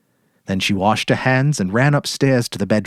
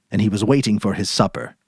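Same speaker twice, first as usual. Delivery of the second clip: usual speaking voice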